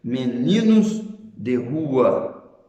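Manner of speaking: slow, careful reading of meninos de rua; deliberate teaching delivery; slow contrast example. Shown as a demonstration. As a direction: spell out meninos de rua slowly, stressing de. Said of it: "Veja, por exemplo".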